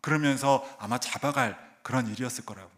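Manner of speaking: normal talking voice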